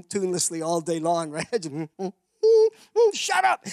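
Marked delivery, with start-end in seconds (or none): 3.1-3.6 high loud snap; wife telling him to shut up; sharp shout. Retold or imitated. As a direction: bark it out in irritation. Briar snapping at humming.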